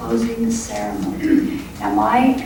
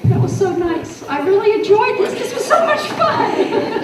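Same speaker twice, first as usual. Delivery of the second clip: high, loud, gushing delight breaking into a laugh; thrilled, laughing as it ends; beaming and laughing after the class; 12 semitones higher, 4 dB louder than usual. Real, not acted.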